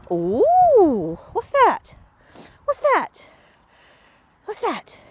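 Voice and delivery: high, loud, eager puppy talk; excited coaxing. Real, not acted. Trainer to her dog Magpie at the beeping box, high excited coaxing. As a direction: hype the dog up about the new sound.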